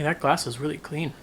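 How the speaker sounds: ordinary delivery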